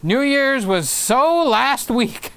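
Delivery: slow, drawn-out line with SO stretched and high; reading David's comment. Retold or imitated. Reading a viewer's teasing comment aloud, drawn-out and mocking. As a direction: drawl "so" like it's so over. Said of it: "David says".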